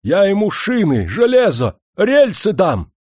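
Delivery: high, loud furious outburst; rising fury. A narrator's reading. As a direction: shout it in rage. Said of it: "наливаясь яростью".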